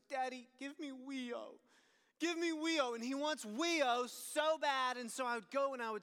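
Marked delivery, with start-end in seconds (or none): 0.1-2.9 put-on toddler begging. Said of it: "And he goes".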